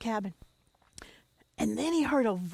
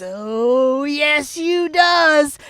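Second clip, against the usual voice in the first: creature voice; 2 semitones higher, 11 dB louder, much slower than usual. Acted. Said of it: "that voice says".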